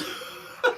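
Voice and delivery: soft unvoiced chuckle; a quiet airy laugh; breathy laugh. Real, not acted. Vlogger amused by pizza complaint, soft laugh.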